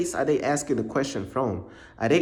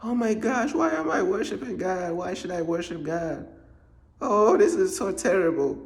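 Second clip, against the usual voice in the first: a lightly whiny parody of a crying man; mock crying; 4 semitones higher than usual. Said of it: "grown men crying about worshiping God".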